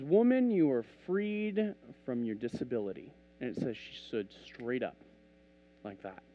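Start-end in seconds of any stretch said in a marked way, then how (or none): 0.0-3.1 unhurried, declared with weight; measured pronouncement. Acted. Jesus to the bent woman, measured pronouncement. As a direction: draw out FREED as a pronouncement. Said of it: "Jesus looks at her and he says".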